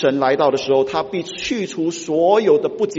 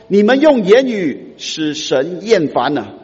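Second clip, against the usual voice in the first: louder, slow solemn prophet rebuke; prophet's rebuke; 4 semitones higher, 7 dB louder, slower than usual. Acted. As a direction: pronounce it like a prophet. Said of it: "先知马拉基对于那个会众". (先知严厉地责备会众)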